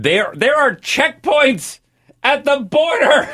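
high, slow and disbelieving; incredulous. Real, not acted. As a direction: stretch it out in disbelief. Incredulous at border checkpoints.

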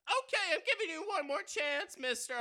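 loud, high robber yell; frantic shouting hostage taker. Acted. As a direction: bark it like a stick-up man. Robber interrupts host.

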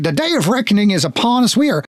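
high-pitched guard making a hurried exit; fed-up departing guard. Acted. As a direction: pitch it up, indignant then gingerly polite. Guards quit and edge past her.

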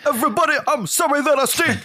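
high, fast squeaky cartoon voice; silly high voice. Voicing a stinky band member.

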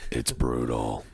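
deep metal growl. Pretend. Echoes metal growl joke.

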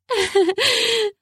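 high light giggle. Acted. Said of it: "She giggled".

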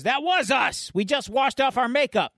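high, worked-up gang member; mock indignant protest.

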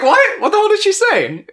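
high, loud, laughing disbelief; shocked. Retold or imitated. Retelling his call to Ben, mock-shocked.

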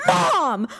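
high, exasperated protest; mock pissed-off yell. Son complains to Mom about the video, mock pissed-off. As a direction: imitate an annoyed kid calling Mom. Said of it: "He was so pissed".

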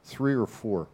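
usual speaking voice

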